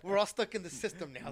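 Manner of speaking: loud, high, through laughter; laughing. Real, not acted. Guest joking to host about adult life, laughing loudly. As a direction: crack up while lamenting it.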